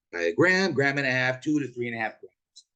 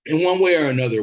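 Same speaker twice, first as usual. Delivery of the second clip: sudden loud outburst; 5 semitones higher, 8 dB louder, faster than usual. Acted. Ranting about playoff refs.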